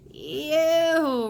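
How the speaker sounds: high, loud revolted roar; disgusted roar. A narrator's reading. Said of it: "roared the blood bottler".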